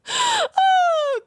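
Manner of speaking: loud, high squealing laugh; real high shriek of laughter. Real, not acted. Cracking up at own joke.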